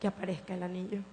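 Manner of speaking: very soft, hushed pleading; a frightened girl begging God softly; soft weeping plea from a little girl; child's tearful prayer. Retold or imitated. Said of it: "llorando le decía".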